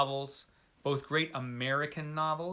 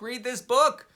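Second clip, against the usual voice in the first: pushed up high and louder on book; emphatic urging; 9 semitones higher, 5 dB louder, slower than usual. Real, not acted. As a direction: insist they go read it. Urging viewers to read it.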